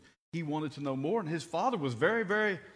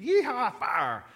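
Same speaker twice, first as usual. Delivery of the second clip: high, loud hammed-up yee-haw; mock wild whoop; 8 semitones higher, 7 dB louder, much slower than usual. Preacher mocks crazy fire preaching with a hammed-up whoop. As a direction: ham up a rowdy cowboy cry.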